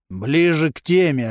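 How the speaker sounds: ordinary delivery, nothing marked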